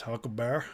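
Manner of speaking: Huckleberry with a Southern dropped R; Southern Huckleberry ending in Bear; Huckleberry as in the clip. Retold or imitated. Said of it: "He says".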